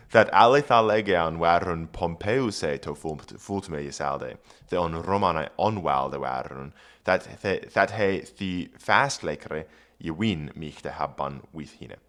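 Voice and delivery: put-on Old English read aloud; careful Old English reading. Shown as a demonstration. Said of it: "reads Old English".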